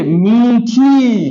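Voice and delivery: मीठी slowly, both ee vowels held long. Shown as a demonstration. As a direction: stretch both syllables of मीठी: mee-thee. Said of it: "बड़ी की मात्रा है दोनों में". (मीठी में दोनों लंबी ई)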